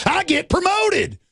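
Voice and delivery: high, slow sneering bureaucrat; sneering high parody of a cop; mocking cop voice. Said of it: "He's like".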